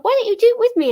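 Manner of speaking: mum's eager invite. Retold or imitated. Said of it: "she was like".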